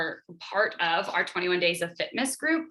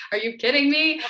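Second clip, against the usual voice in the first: louder mock disbelief, voice jumping high; incredulous; 6 semitones higher, 6 dB louder than usual. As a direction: scoff at it like it can't be real. Friend calls Beachbody coaching a job.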